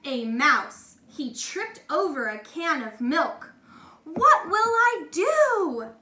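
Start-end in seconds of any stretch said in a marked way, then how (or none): 4.0-5.9 high, slow cry of despair. A narrator's reading. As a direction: wail it out slowly. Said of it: "cried Walter".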